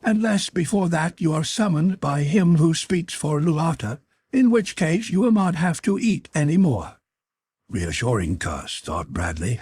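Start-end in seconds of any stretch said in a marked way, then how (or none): 0.0-7.0 high, strange creature voice, announcing. A narrator's reading. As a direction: proclaim it like an eerie winged being. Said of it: "announced the creature".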